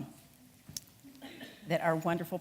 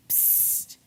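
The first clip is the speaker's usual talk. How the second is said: whispered psst; 5 dB quieter than usual. Acted. Roadrunner whispers to wake Bernie.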